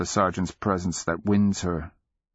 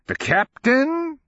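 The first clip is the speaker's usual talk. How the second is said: put-on high, drawn-out incredulous old man; cackling reproach; 3 dB louder, slower than usual. A narrator's reading. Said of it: "in cackling reproach".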